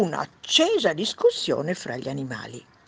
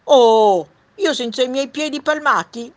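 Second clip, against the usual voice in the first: loud, higher-pitched duck voice; 9 semitones higher, 13 dB louder than usual. Acted. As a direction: pipe up loudly as a storybook duck. Duck voice in read story. (voce acuta e forte da anatra)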